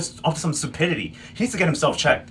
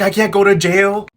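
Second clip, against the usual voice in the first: louder, slow, hammed-up plea; mock desperate wail; 5 semitones higher, 8 dB louder than usual. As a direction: wail it like a caught villain begging. Josiana quoted begging Amadeo.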